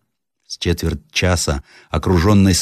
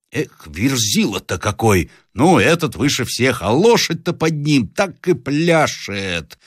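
put-on boy's voice, drawn out and rising high; мальчик-наблюдатель; 7 semitones higher, slower than usual. A narrator's reading. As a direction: marvel aloud as a young lookout. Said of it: "закричал мальчик". (протяжный мальчишеский голос)

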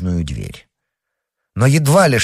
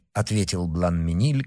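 ordinary delivery, nothing marked

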